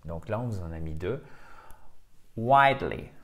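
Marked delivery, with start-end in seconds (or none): 2.4-3.1 "Widely" with the mouth wide open on the wi. Shown as a demonstration. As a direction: stretch the vowel of "Widely" wide. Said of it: "Il faut vraiment ouvrir la bouche".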